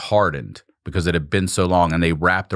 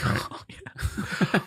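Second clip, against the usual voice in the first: relieved; 11 semitones higher, 9 dB quieter than usual. Retold or imitated. Relief of finally peeing.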